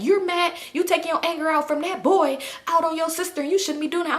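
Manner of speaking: scolding grandma. Retold or imitated. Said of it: "And my grandma was like".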